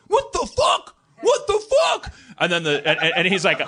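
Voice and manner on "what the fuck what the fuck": shrieking high in panic; panicked shriek. Retold or imitated. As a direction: squeal it like a terrified man. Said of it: "goes like".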